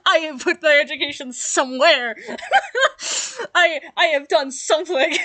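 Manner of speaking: laughing while protesting. Real, not acted. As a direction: laugh it out, half defensive. Defending herself against the roast.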